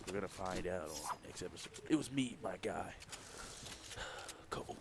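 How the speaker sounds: low, hushed storyteller with long pauses; mock dramatic narration. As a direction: play the grim war-story narrator, trailing into a whisper. Mock dramatic episode narration of gameplay.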